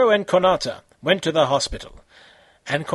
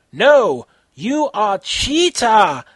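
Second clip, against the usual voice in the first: louder, slow accusing shout; hammed-up accusing shout; angry yell; 5 semitones higher, 6 dB louder, much slower than usual. A narrator's reading. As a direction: call him out, slow and loud. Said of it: "Konata yelled".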